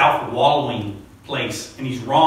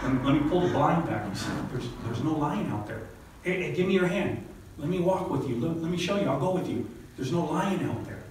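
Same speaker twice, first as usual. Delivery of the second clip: softer, tender coaxing; gentle reassurance; 7 dB quieter than usual. Acted. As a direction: coax him gently by the hand. Gently leading the frightened man.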